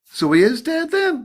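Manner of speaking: high, slow dismayed question; shocked question. Retold or imitated. Friend shocked.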